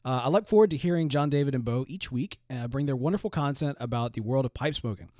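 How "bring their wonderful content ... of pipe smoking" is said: normal talking voice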